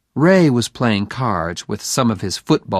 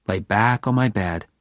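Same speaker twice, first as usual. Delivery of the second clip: plain reading voice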